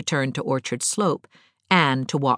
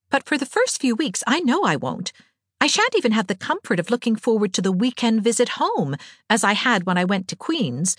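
higher, earnest young woman's voice, lightly put on; Anne, girlish; 8 semitones higher than usual. A narrator's reading. Anne dreading first weeks away from home, mildly plaintive. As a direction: confide misgivings in a higher girlish voice.

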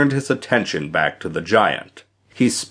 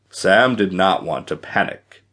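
usual speaking voice

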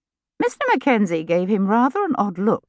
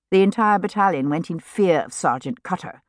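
ordinary delivery, nothing marked

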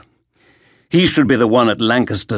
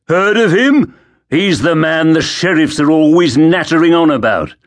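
sneering guard; 6 semitones higher, 4 dB louder than usual. Acted. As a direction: taunt him like a street thug. Guard taunts Crispin.

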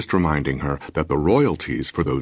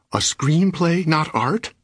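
much higher, indignant rising question on "not art"; husband crying out in offended disbelief, higher; 12 semitones higher, slower than usual. A narrator's reading. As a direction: fling "screenplay" back in disbelief, pitched way up. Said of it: "I cried".

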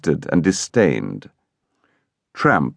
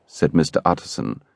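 ordinary delivery, nothing marked

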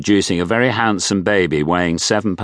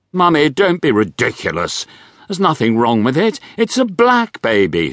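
put-on high, impatient posh young woman; a prim daughter, higher; 8 semitones higher than usual. A narrator's reading. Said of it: "she replied".

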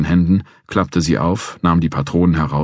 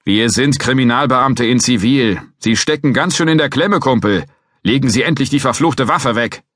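called out; 8 semitones higher, 4 dB louder than usual. Acted. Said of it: "rief ich".

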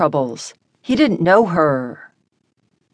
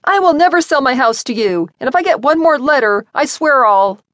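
higher and louder, pushing faster mid-line; angry yell; 8 semitones higher, 4 dB louder than usual. A narrator's reading. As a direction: keep shouting your threat. Sarah still yelling into intercom.

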